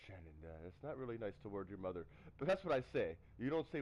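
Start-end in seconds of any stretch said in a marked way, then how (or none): 0.1-2.0 inner voice. Retold or imitated. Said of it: "inside I'm going".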